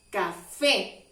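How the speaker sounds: "Café" with a firm, stressed final "fé"; fuerza en la E. Shown as a demonstration. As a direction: put the force on the last letter of "Café". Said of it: "hacemos más fuerza en la E". ("Café" con más fuerza en la "é" final)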